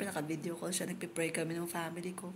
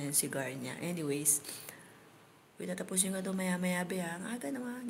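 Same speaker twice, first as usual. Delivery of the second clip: normal talking voice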